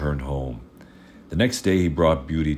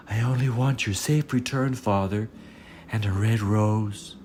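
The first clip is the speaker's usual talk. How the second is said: Beauty's voice; 6 semitones higher than usual. Acted. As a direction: speak up as the modest youngest daughter. Beauty answers her father.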